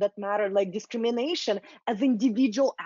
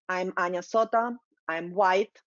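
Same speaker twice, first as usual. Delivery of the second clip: usual speaking voice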